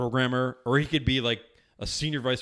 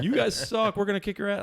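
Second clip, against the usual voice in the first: retold taunting; 9 semitones higher than usual. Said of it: "banging on the window, going".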